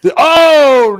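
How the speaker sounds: sudden loud high exclamation; excited outburst. Real, not acted. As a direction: burst out at the big punch. Reacting live to fight action.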